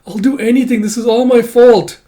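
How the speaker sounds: put-on high, loud childlike pleading; a frightened child begging the Goddess; Baby Micah. Baby Micah begging the Goddess for forgiveness, high and tearful. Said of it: "Baby Micah's like".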